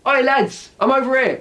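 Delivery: high call across a room. Shown as a demonstration. Teacher calls out to the lads to come over. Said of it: "get their attention".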